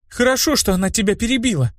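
high, mild relief; relieved. A narrator's reading. Blonde glad her friend was interrupted, mildly relieved. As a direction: let a touch of relief through. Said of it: "с облегчением сказала блондинка".

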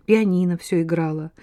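ordinary delivery

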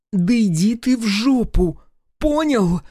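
high, slow furious woman's voice; drawn-out high-pitched angry outburst; suddenly enraged. A narrator's reading. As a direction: blow up at him, pitch shooting up. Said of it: "Внезапно осатанела Гюрза".

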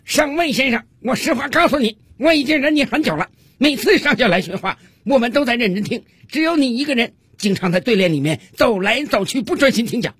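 high-pitched, indignant shouting; acted soldier hollering, high all through; shouted rebuke. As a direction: shout a fed-up complaint at your officer. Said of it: "冲着上尉大声喊道".

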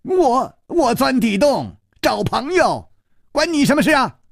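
high, stammering yell; roaring. Acted. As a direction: bark it back in a fluster. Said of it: "又跳起来吼道".

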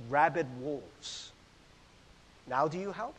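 ordinary talk

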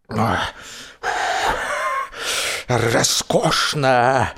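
slow wheeze, spluttering through water; wheezing. A narrator's reading. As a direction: croak it out slowly while blowing bubbles. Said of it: "прохрипел я".

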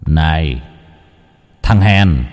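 low, taunting inner demon; a hint of a mocking dark double; sinister shadow voice. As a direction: sneer at him from the wall. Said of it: "hắn hiện ra dưới hình dạng cái bóng".

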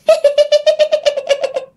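very high, stuttering spooky cackle; eerie ghostly laugh. Acted. Dead woman laughing at a drunk neighbour, eerie high cackle. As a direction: let out a high, creepy ghost giggle. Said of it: "nghe cái tiếng bà cười nghe ghê lắm".

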